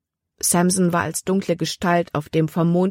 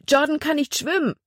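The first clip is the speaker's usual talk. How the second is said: much higher, panicked cry; frightened cry; 10 semitones higher than usual. A narrator's reading. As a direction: cry out in alarm for the boy. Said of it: "Angst ergriff Libbys Herz". (ängstlicher Aufschrei, viel höher)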